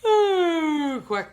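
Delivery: loud yawn ending in a quack; playful duck yawn with a quack; quacky duck yawn. Shown as a demonstration. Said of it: "a quacky yawn".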